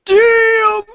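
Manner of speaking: high, loud exclamation; excited shout. Real, not acted. Player reacting to the initiative roll, excited shout. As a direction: yell it in excitement.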